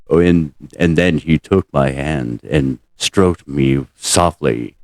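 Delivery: deep, slow, breathy-free mock reading of a steamy passage; low, slow impression of Takei reading erotica; George Takei reading. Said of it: "he's just sitting there going".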